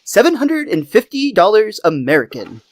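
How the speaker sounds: sarcastic know-it-all impression; Comic Book Guy. Shop owner turning down the kid's offer, sarcastic. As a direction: lightly put on a scornful, sarcastic collector. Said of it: "I love a comic book guy".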